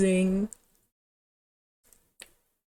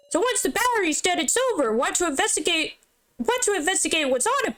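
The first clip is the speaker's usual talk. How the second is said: much higher, fast, eager chatter; fast high character; 9 semitones higher, faster than usual. Acted. Companion urges Sarah to check the laptop, much higher and fast. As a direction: rattle it off like an excited companion.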